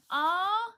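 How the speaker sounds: high, loud, rising "aw"; touched "aw". Real, not acted. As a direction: let out a long, rising aw of affection. Touched by the synopsis just read.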